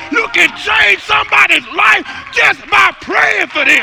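loud, high shouted preaching over the music; a pastor shouting the message; full-force preaching. Acted. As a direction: preach it hard to the whole church.

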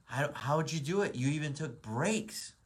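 put-on young buck confronting the older man, demanding how he won; pissed-off challenge. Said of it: "he was pissed off".

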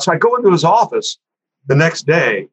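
normal talking voice

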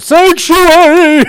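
high, slow yelled Sanctuary; Quasimodo yell. Retold or imitated. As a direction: cry out like the hunchback. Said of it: "why he yelled out".

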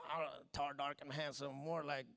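very low, soft grumbling; disillusioned grumble. Acted. Spouse reconsidering tall, dark and handsome, low disgruntled grumble. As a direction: grumble it low under the breath.

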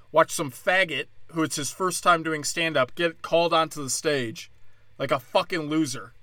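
ordinary talk, nothing marked

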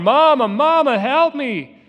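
put-on high, loud child's cry for his mother. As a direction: call for mama through tears. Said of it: "And he's crying".

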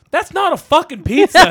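high, loud indignant retort; mock outrage. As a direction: imitate an exasperated outburst, loud and high. Acting out movie's angry retort.

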